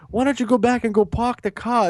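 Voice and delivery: put-on taunting fan's high-pitched Boston accent; mock someone with a Boston "pahk the cah". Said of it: "In the Boston accent".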